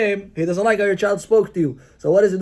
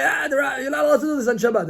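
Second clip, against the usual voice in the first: quick rebuke; 6 semitones higher, faster than usual. Retold or imitated. Quick rebuke of spouse on Shabbat.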